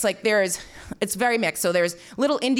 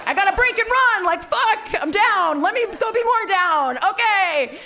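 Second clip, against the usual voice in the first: much higher, laughing mock drama; mock dramatic; 9 semitones higher than usual. Storming off from the disapproving couple, high hammed-up laughing. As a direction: ham up a petty getaway, high and giggling.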